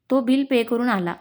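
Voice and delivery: plain reading voice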